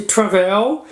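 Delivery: travel with the beat moved to the end, tra-VEL; stress on last syllable. Shown as a demonstration. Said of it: "seria escrita com dois L's". (travel com a tônica no fim, tra-VEL)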